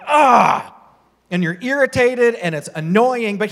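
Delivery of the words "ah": a short loud cry of frustration, higher; frustrated yell. Retold or imitated. Preacher mimics reacting to a cancelled plan with a frustrated yell. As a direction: let out an exasperated loud ah. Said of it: "And then you're just like".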